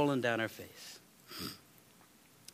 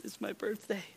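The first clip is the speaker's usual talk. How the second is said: put-on crying girl; 9 semitones higher, 7 dB quieter than usual. Retelling a crying little girl.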